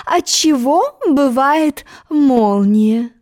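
very slow, drawn-out question. Acted. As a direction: ask slowly, savouring it.

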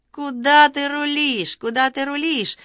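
high, drawn-out yell. A narrator's reading. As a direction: yell it high, stretching the words. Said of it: "завопил, судя по голосу, Игорь Кукин". (протяжный высокий вопль)

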